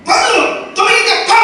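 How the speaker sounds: put-on high, sharp shout at the crying child; a parent losing patience, snapping high; yelling parent. Parent scolding a screaming child, angry high shout.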